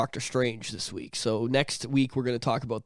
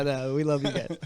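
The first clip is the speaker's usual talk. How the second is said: usual speaking voice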